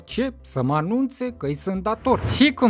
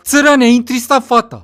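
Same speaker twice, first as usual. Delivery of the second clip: much louder, jeering rebuke; loud mock scolding; 3 semitones higher, 9 dB louder than usual. Friend blamed for upsetting the girl, loud mock scolding. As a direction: scold a friend at full volume. Acted teasing between friends.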